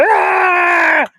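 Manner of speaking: high, loud roared shout; bear roar. A narrator's reading. Grizzly roaring at Jack, a loud high roar. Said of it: "and he roared".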